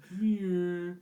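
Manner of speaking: mock puppy-calling coo; coaxing a puppy. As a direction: call her in like a pet.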